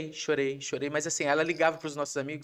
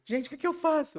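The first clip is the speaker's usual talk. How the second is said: much higher, louder voice of a flustered wife; mimicking anxious wife; 13 semitones higher, 5 dB louder than usual. Husband mimicking his wife asking friends for help, high and flustered. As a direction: squeal it like a panicked friend. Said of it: "ligou pras nossas amigas".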